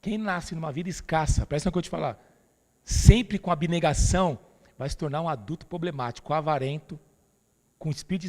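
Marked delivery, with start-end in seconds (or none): 2.8-4.4 preaching emphasis. Real, not acted. Preacher stressing point.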